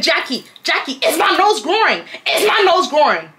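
high-pitched pestering question; childish mock questioning. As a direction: pester a friend with mock innocence. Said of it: "I am childish".

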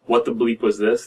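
ordinary talk, nothing marked

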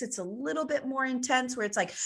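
ordinary talk, nothing marked

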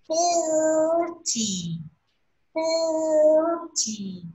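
"forty" with FOR high and held long, TY dropping; slow drill of "forty", high first syllable; first-syllable stress. Shown as a demonstration. Teacher drilling first-syllable stress, long high FOR in "forty". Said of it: "Trọng âm nhấn đầu tiên đúng không?".

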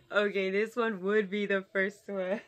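talking through laughter; laughing. Real, not acted. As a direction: laugh while speaking. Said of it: "see why I'm laughing right now".

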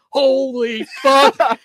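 high, slow, stretched-out exclamation; mock astonishment. Equipment manager believes the fake signing, astonished. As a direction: imitate a floored friend, drawing out each word. Victim's reaction to confirmed prank.

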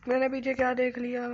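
high, loud cry of shock; alarmed yell after a scare; startled alarm. Real, not acted. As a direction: blurt it out in fright. Startled by what is behind.

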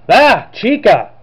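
slow startled gasp of a name. Real, not acted. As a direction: caught off guard by Chica. Startled by Chica appearing.

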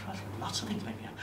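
fast, breathless whispered reply; sheepish whispered answer to the guru; meek whisper. Retold or imitated. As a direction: whisper it quickly and timidly.

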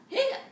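squeaky jumped-up hiccup on the word; cute little piglet hiccup; high hiccup. Acted.

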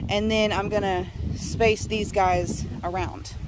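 usual speaking voice

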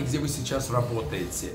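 ordinary talk

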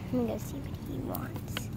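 hushed aside; whispering. Acted. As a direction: whisper it to your friend. Aside to friend.

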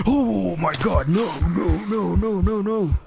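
real fright; panicked no. Real, not acted. Fleeing creature, panic.

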